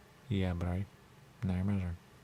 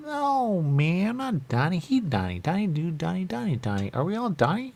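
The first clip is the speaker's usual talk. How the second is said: agitated protest; 10 semitones higher, 5 dB louder than usual. Acted. Rejecting being taken for Donnie, agitated protest. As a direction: protest loudly, pitch leaping up.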